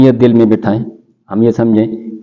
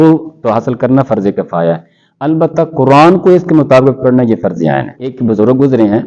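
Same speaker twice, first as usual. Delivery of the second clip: ordinary talk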